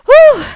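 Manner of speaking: loud, high puff of relief after racing; breathless whew. Real, not acted. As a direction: blow it out like you just finished a sprint. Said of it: "I got so fast there at the end".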